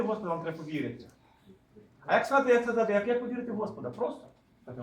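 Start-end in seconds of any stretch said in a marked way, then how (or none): none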